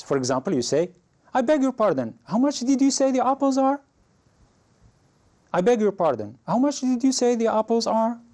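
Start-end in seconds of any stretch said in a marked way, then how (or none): 1.3-3.8 high-pitched, courteous customer's question; very high, polite request for a repeat; polite query. Shown as a demonstration. Customer politely asks shopkeeper to repeat. 5.5-8.2 high-pitched, courteous customer's question; very high, polite request for a repeat; polite query. Shown as a demonstration. Same polite question modelled once more.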